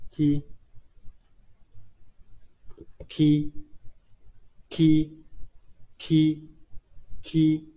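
key drawn out very slowly; 非常缓慢地拖长读key; slow ki drill. Shown as a demonstration. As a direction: stretch each key for learners to copy.

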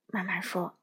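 plain reading voice

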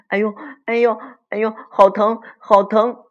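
high, loud yelps of pain; cry of pain. Acted. 可可 yelling as the spanking lands, acted cry of pain. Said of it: "可可疼得大叫".

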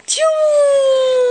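a sharp gasp bursting into a loud sneeze. Acted. Wolf sneezes at the brick house, loud gasping sneeze. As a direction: build the breath, then let the sneeze explode.

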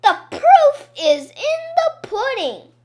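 high, loud triumphant cry; shouting. A narrator's reading. Boy pointing at pudding-splashed tattoo, shouting. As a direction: yell it out as proof. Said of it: "Michael shouted".

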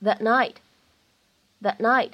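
"that night" with the t of "that" held and not released; "that night" 不完全爆破; unreleased t. Shown as a demonstration. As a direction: stop on the t of "that" silently, then "night". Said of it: "我们呢可以读成是that night".

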